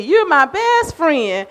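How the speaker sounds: playful imitation of a little granddaughter. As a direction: stretch it out like a doting granddaughter. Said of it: "I got a granddaughter, she loves to say".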